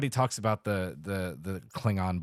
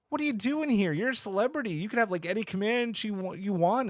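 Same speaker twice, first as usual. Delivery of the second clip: a much higher, excited guy who can't believe it; lightly hammed-up high-pitched fan; mock dude voice; 11 semitones higher than usual. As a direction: squeak it out like a starstruck crewman. Retelling the dude's lines in the movie.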